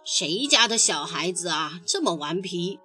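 low-pitched storybook grumbler; angry, grumpy catfish. A narrator's reading. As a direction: scold the little ones in a deep voice. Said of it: "生气地问".

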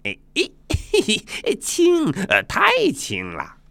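high, giggling monkey voice; squeaky scoff with a quick giggle; Sun Wukong. Acted. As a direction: giggle and dismiss it playfully. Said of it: "孙悟空接过了大环刀".

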